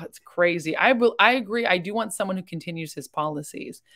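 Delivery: normal talking voice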